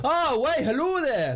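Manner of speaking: high, sing-song mock Geordie; hammed-up Geordie lilt; terrible Geordie accent. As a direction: put on a bad Geordie greeting. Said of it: "terrible Geordie accent".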